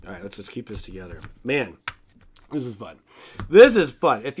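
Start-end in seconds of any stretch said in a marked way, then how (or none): 3.5-4.2 louder, higher burst of real fun; excited. Real, not acted. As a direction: punch it out with real delight. Repeats phrase, second time louder.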